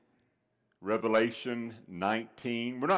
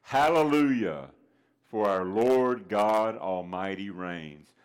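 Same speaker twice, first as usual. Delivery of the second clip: slow, booming pulpit refrain; proclaimed refrain; 4 dB louder, slower than usual. Acted. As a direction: ring it out to the whole church. Repeats the sermon refrain.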